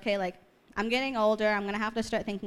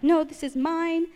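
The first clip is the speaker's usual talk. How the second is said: high, loud, drawn-out protest; possessive refusal; 8 semitones higher, 5 dB louder, slower than usual. Retold or imitated. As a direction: refuse to let go, stretching the words up high. Retold clutching, refusing to give up.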